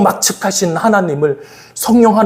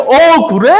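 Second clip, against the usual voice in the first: eager, raised-voice surprise; higher, louder, pleasantly startled; surprised delight; 6 semitones higher, 5 dB louder, slower than usual. Retold or imitated. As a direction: react as if it's wonderful news. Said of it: "눈을 탁 크게 뜨고".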